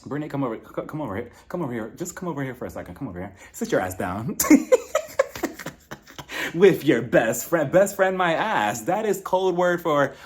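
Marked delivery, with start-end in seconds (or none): 4.4-5.7 high airy laugh. Real, not acted. Laughing at own joke.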